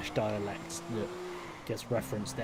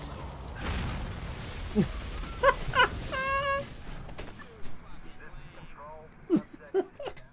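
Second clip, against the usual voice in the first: long, squeaky fit of laughter; high-pitched laugh. Real, not acted. Player laughing after the car catches fire, high-pitched laugh. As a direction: burst into high, helpless wheezy laughter.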